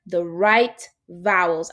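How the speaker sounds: spelled out slowly like a warning sign; slow, emphatic warning-sign read. Shown as a demonstration. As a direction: read it like a posted caution sign, deliberately. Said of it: "our sign should say".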